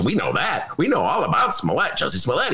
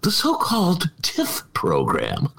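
sniffy dismissive; 4 semitones higher, slower than usual. Retold or imitated. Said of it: "the Tribune's attitude is".

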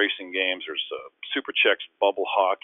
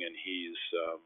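ordinary delivery

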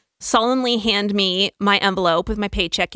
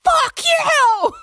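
much higher, louder "Fuck you" thrown straight back; boss yelling back; 5 dB louder than usual. Retold or imitated. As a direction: shout "Fuck you" back at the leaving employee.

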